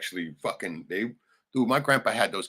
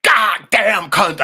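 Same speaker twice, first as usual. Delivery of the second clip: much higher, louder, slow outburst; imitating a sudden yell; 13 semitones higher, 13 dB louder, much slower than usual. Imitating the brother yelling at the TV. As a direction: bark the curse out of nowhere. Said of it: "he just like".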